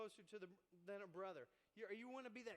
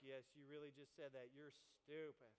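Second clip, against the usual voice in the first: mocking onlookers; 8 semitones lower, 4 dB quieter than usual. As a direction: drag out a flat judgment. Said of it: "people are like".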